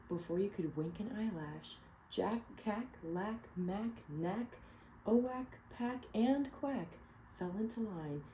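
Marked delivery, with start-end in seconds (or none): none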